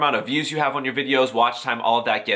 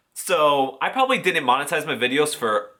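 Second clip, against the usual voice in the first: ordinary talk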